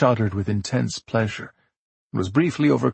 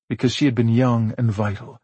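ordinary delivery

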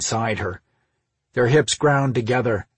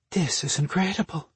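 breathed; 7 semitones higher than usual. A narrator's reading. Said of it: "Marina breathed".

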